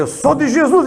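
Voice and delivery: declaring high and loud; a bold cry of faith; loud declaration. Retold or imitated. Repeated declaration, demon manifests.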